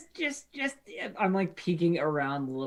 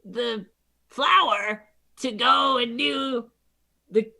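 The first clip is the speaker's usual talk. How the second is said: nervous kobold; 9 semitones higher, 3 dB louder, slower than usual. Acted. Kobold nervously haggles with queen.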